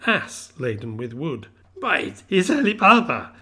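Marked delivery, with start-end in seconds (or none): 1.8-3.3 high, loud cry of delight; ecstatic exclamation. A narrator's reading. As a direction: burst out in joyful wonder. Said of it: "Scrooge exclaimed in ecstasy".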